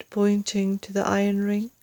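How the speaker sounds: usual speaking voice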